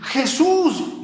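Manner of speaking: high, drawn out call to Jesus; crying out. Retold or imitated. Homeless man crying to heaven at 3 am, high cry. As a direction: cry the name up to the sky.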